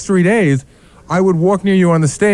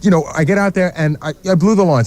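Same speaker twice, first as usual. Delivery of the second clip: usual speaking voice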